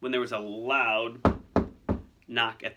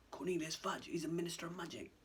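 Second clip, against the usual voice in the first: hushed, higher, secretive undertone; breathed whisper; 6 semitones higher, 11 dB quieter than usual. Acted. Ron hiding, telling Harry who arrived, whispering. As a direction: keep it very soft, barely breathed. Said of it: "Ron breathed".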